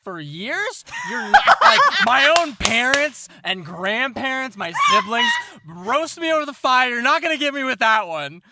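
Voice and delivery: high loud laughing retort; laughing brag. Acted. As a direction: scoff it off through laughter. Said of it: "he goes".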